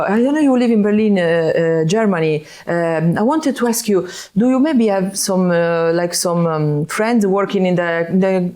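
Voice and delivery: a South Italian guy fumbling for the club name; South Italian accent. Retold or imitated. Fan begging for a guest list, in a South Italian accent. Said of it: "Imagine, like, the accent".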